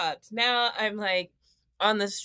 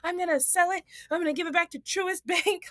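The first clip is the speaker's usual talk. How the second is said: higher, fed-up mock rant; exasperated rant; 8 semitones higher than usual. Homeowner fed up with minor problems, higher exasperated rant. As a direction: vent like you want to dump the house. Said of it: "suddenly I'm like".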